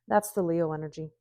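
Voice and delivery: ordinary delivery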